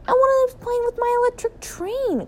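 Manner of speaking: put-on very high little-boy wail; distressed cry. A narrator's reading. Son seeing a duck with his train, high wail. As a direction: cry out high, like a wronged child. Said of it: "cried Philip".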